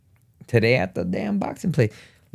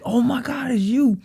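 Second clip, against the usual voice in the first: starstruck fan; 11 semitones higher than usual. Retold or imitated. Imitating the starstruck outlet clerk, high-pitched and gushing. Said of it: "when he said".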